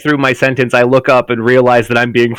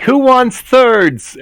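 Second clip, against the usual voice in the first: high, drawn-out offer to the room; chirpy call-out; 12 semitones higher, 4 dB louder, much slower than usual. Acted. Asking who wants thirds after smiling slyly, high drawn-out call. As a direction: call it out high and stretched, breaking the awkwardness.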